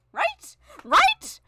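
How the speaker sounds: high loud right, rising; excited high squeal. Real, not acted. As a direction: squeal it, demanding agreement. Emphatic repeated question.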